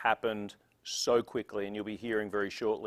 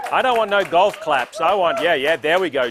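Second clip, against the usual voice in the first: louder and higher, pushing for cheers; rousing the crowd; 5 semitones higher, 12 dB louder than usual. Real, not acted. Emcee demanding real cheers, not golf claps, loudly. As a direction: whip up the applause.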